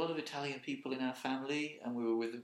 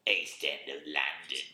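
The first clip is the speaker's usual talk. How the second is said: lower, slow Cockney echo; gruff mock Cockney; 8 semitones lower, slower than usual. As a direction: growl it like an East End bloke.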